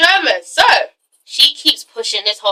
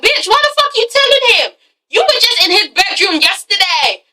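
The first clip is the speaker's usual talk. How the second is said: high-pitched acted scolding; indignant telling-off; 8 semitones higher than usual. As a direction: call her out, pitched well up. Indignantly telling Asha off in retelling.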